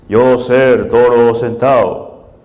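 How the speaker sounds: slow, put-on broken-Spanish character voice; Toro Sentado. Mimicking someone who speaks without grammar, slow caricature. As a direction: plod through each word heavily.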